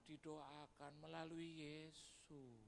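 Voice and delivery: slow, soft reply; humble guest. Retold or imitated. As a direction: answer quietly and slowly. Guest explains.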